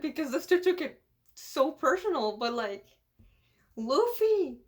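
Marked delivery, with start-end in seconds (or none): 3.8-4.6 high, loud yell; acted outburst, pitched up; shouted name. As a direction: holler the name out. Acts a character's outburst.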